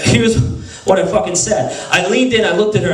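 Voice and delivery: ordinary delivery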